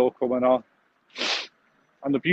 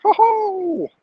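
much higher, hammed-up comedian's laugh-line; mock showbiz ho ho pitched high; Jimmy Tarbuck. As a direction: toss it off like a cheeky old stand-up. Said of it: "That's Jimmy Tarbuck".

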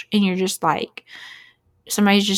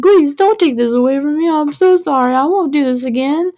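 high, loud mock-crying plea; whining seven-year-old; 6 semitones higher, 5 dB louder than usual. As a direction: beg like a kid in trouble, voice pitched up. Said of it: "that's literally what they sound like".

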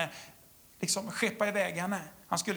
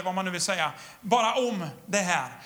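normal talking voice

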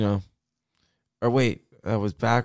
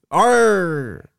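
loud, higher mock pirate "arr"; pirate growl; 6 semitones higher, 5 dB louder than usual. Joker answering plans for Saint Patrick's Day, mock pirate. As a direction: draw it out like a pirate. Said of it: "that's Saint Pirate's Day".

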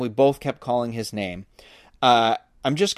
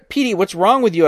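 higher, louder concerned call; calling to a dog; 7 semitones higher, 5 dB louder than usual. A narrator's reading. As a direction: call out to your dog, pitch raised. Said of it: "I called to the dog".

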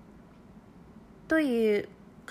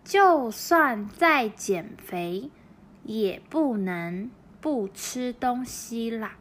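就算在减肥 read very slowly and evenly, word by word; slow model reading; 3 dB louder, much slower than usual. Shown as a demonstration. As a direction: read the Chinese sentence slowly, like a teacher for learners. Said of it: "その後のAさん". (ゆっくり丁寧な模範読み)

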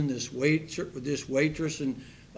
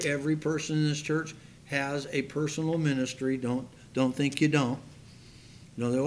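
ordinary talk

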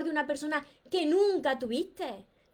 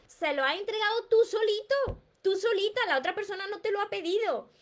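higher, teasing reproach; pointed emphasis; 7 semitones higher than usual. Real, not acted. Coach to viewers who gave away their happiness, teasing higher reproach. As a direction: make them hear they gave it away.

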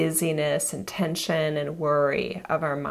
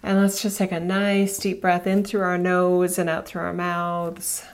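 guided meditation. Acted. Leads a breathing meditation.

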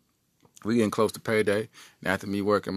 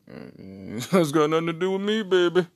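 higher, quick, laughing brush-off; brother's cool dismissal; 7 semitones higher than usual. Retold or imitated. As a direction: brother wanting no part of it. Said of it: "turned his head like".

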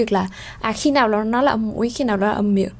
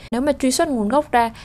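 ordinary talk, nothing marked

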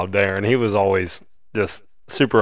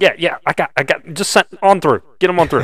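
loud, high-pitched hurried brush-off; hammed-up busy official's quote; retold distracted official; 9 semitones higher, 5 dB louder than usual. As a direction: wave them through impatiently, as an impression. Lead tech guy waving them off.

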